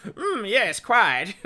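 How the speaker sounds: high, loud mock British; hammed-up British toff parody. Host imagining Vader's real voice, high mock British accent. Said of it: "like, a higher pitched British voice".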